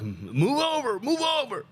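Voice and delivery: high, barking orders in a put-on voice, climbing on the second; mocking a strung-out guy commanding the others; bossy junkie. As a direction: shoo the zombies aside like a foreman. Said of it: "and he's like".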